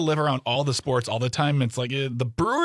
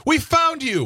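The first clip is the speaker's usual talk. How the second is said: excited; 4 dB louder, slower than usual. Pretend. Joking reenactment of rescued workers.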